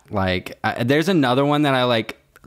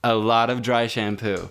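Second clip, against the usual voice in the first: ordinary talk, nothing marked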